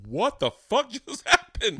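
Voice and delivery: high, slow, drawn-out shock; mock-appalled and drawling high; stunned disbelief. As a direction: stretch it out, incredulous, with a laugh. Retold shock at father's order.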